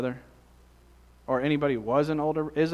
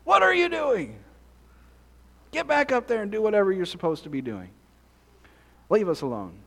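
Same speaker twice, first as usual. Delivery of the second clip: lightly hammed-up family squabble; sibling scolding; faster than usual. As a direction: imitate a bossy older sibling shooing you away. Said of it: "those kinds of conversations at your house".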